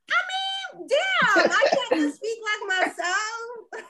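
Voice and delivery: high, drawn-out protest breaking into laughter; laughing exasperation. Real, not acted. New Orleans visitor told she's not from here, laughing exasperation. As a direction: protest through a real laugh, voice way up.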